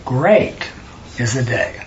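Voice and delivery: "Great" set apart by silence, then dropping low and soft; emphasis after pause. Shown as a demonstration. Teacher showing emphasis by pausing, "Great" isolated and quiet. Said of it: "and then I pause".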